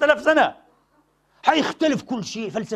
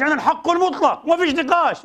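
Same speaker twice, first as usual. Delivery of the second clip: higher, dogmatic imitation; mock absolutist; 7 semitones higher, 3 dB louder than usual. Imitating a zealot refusing any discussion, higher. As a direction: ham up a zealot shutting debate. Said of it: "ما قالش".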